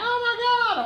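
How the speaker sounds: very high fan squeal; mock excited scream. Lady still screaming during his phone call, mock squeal. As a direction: imitate a fan who won't stop screaming. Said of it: "the lady's still like".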